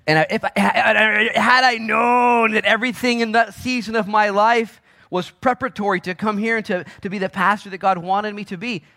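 loud, high, shouted realization; full-force preaching. Real, not acted. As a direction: shout it out from the pulpit.

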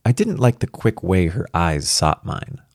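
ordinary delivery

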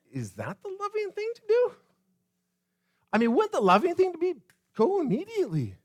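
high-pitched disbelief; incredulous questioning. Acted. Preacher questions Jesus's delay before the church, incredulous.

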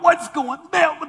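high, slow shocked cry of disgust; horrified partygoers. Retold or imitated. Partygoers recoiling from a head wound, high and shocked. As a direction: gasp it out like a horrified guest. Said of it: "they're like".

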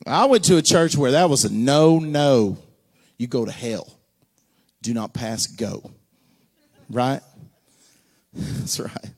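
normal talking voice

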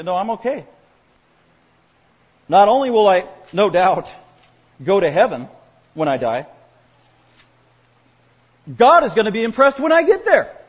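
mock confident believer boasting of heaven; smug self-assurance. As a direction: ham up a self-satisfied believer. Voicing a self-deceived believer.

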